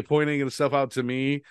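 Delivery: ordinary talk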